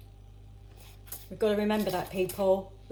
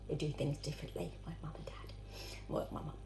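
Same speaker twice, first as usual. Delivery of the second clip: whispered aside; 4 semitones lower, 11 dB quieter, faster than usual. Real, not acted. Confiding aside about her parents.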